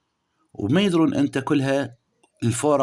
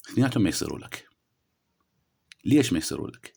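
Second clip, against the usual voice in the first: usual speaking voice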